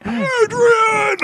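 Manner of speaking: high, wailing movie-boxer yell; Sylvester Stallone. Acted. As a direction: bellow the name like a desperate screen boxer. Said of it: "You can do a Sylvester Stallone impression".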